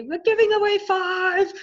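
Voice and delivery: high, loud, slow big reveal; excited reveal. Real, not acted. Host revealing five prizes to viewers, thrilled. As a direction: land the surprise number with delight.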